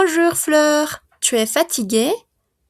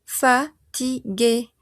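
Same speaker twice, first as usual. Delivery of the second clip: slow model word; much slower than usual. Shown as a demonstration. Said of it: "Je suis".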